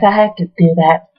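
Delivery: ordinary delivery, nothing marked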